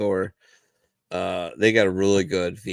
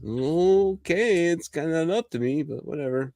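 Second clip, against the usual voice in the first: mock-careless private who won't listen; dismissive soldier; 8 semitones higher than usual. Soldier shrugs off medic's advice.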